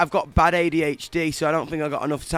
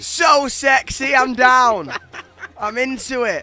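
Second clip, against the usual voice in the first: excited; 8 semitones higher, 8 dB louder, slower than usual. Real, not acted. Reacting to the Czech language.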